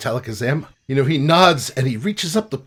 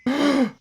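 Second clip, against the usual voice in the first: shocked gasp; 11 semitones higher than usual. Acted. GM voices frogs' gasp.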